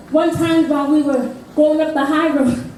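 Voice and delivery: ordinary delivery, nothing marked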